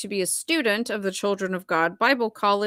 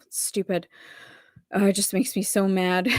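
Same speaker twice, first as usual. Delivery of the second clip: ordinary talk, nothing marked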